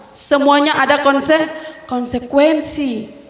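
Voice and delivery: ordinary talk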